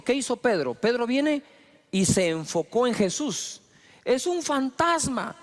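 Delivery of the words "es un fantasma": high, drawn-out acted alarm; mock fearful cry. Disciples sighting Jesus on water, acted fearful cry. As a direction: stretch it out high, as frightened sailors. Preacher re-enacts the frightened disciples.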